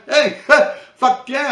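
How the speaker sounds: loud, high self-cheer through a laugh; excited laugh. Real, not acted. Hyping himself up after checking himself out, loud and laughing. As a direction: whoop at his own joke.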